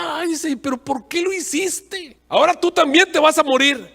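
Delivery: grito agudo de dolor de la rana, cada vez más fuerte; rana herida. Acted. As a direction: chilla de dolor y reproche. (la rana picada reclama al escorpión, chillando agudo)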